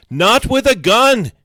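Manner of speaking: high, loud, drawn-out protest; shrieked objection at full force; yelling. A narrator's reading. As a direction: yell it in horror. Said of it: "Graham yells".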